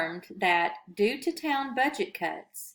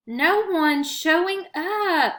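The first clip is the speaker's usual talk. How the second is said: slow, loud, higher moan of dismay; 9 semitones higher, 6 dB louder, slower than usual. A narrator's reading. Manny moans to his friend about the empty steps, slow and loud. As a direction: drag it out in a complaining moan. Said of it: "Manny moaned".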